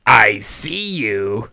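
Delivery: slow, drawn-out menacing prank voice; playfully sinister phone threat, laughing it off after; creepy prank caller. Retold or imitated. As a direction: drag out "I see you" like a horror-movie stalker. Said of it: "I call and I go".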